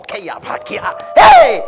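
sudden high, loud yell; exultant shout; triumphant shout. Acted. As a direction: cry out in victory. Congregation declaration, triumphant cry.

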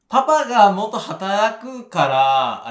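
put-on slow, high, loud Korean-accented Japanese; mock Korean-accented Japanese. Attempting a Filipino woman, slipping into loud Korean-accented Japanese. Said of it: "韓国人なまりの日本語になっちゃった".

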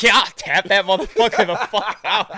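high, loud fired-up yell; retold hype shout; hyped shout. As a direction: yell it to pump him up. Hyping fight talk, retold.

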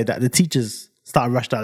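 ordinary talk

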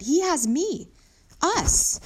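emphatic and high, punched out; insistent protest. A narrator's reading. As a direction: argue for keeping him. Children insist donkey has company.

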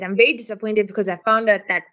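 ordinary talk

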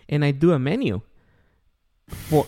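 ordinary delivery

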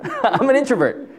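chuckling at his own claim; real laugh in the words. Real, not acted. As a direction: say it through a laugh. Repeats it through a laugh.

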